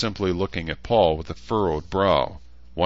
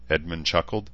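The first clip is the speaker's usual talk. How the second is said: ordinary delivery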